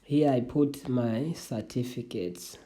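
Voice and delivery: ordinary talk, nothing marked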